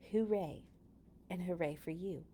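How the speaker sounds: normal talking voice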